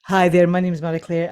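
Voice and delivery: ordinary delivery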